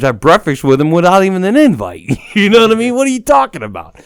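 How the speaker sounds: heated rant after the host called him nervous; indignant rant. Real, not acted. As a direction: get worked up defending your ego. Ranting about his bruised ego.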